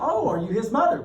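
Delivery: governor's question. Retold or imitated. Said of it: "looks at little Christy and goes".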